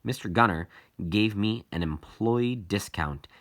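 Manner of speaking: ordinary delivery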